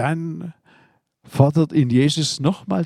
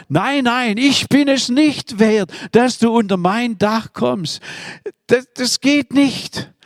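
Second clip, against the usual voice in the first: raised, slow, earnest protest; higher-pitched humble refusal; humble pleading centurion; 8 semitones higher than usual. Acted. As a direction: plead that you are not worthy.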